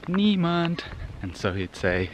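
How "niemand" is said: higher, chanted playground answer; children's group answer. Retold or imitated. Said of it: "everybody else would respond".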